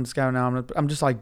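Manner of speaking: ordinary talk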